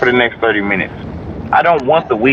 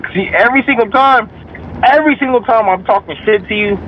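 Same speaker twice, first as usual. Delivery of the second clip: usual speaking voice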